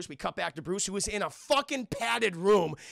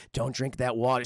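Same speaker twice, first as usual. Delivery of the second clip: low, ominous warning; a sinister inner voice; lowered voice planting paranoia; voices in head; 6 semitones lower, 4 dB louder than usual. Retold or imitated. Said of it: "the voices start coming in".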